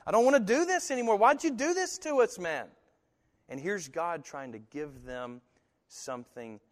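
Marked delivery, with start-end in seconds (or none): 0.1-2.7 mock whining, much higher and fast; hammed-up whine at the deliverer; whiny complaint. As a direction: act out a fed-up grumbler. Israelites complaining to God.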